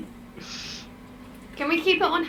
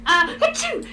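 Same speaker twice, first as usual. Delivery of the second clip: fake sneeze; 8 semitones higher, 3 dB louder than usual. Fakes sneeze as distraction.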